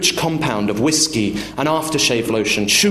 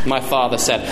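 usual speaking voice

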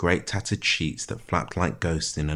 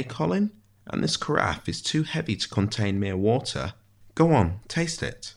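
higher, swooping, precise mock-astonished voice; wry prisoner, teasing; 5 semitones higher than usual. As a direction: tease the jailer with theatrical fussiness. Ishmael teases Colin over the food.

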